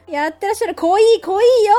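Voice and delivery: 高く張った冷やかし声; 女友達になって大げさに茶化す; 引き気味の女友達の真似. Acted. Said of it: "うわーって言われるタイプの女子。". (女友達の大げさな冷やかし声)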